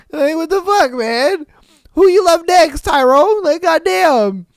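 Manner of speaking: high, loud furious disbelief; jilted rage. Retold or imitated. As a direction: rage at her betrayal. Jilted guy's outraged rant.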